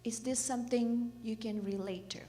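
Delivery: ordinary talk, nothing marked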